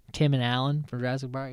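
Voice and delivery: ordinary delivery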